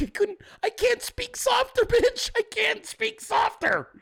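very high strained falsetto protest; comically strangled high-pitched pleading; exasperated squeal. Acted. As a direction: squeak it out at wit's end. Exasperated protest at accusation of yelling.

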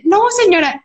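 high, indignant mock protest; enojo actuado. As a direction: act out an angry refusal. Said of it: "Yo súper enojada de". (protesta enojada y aguda)

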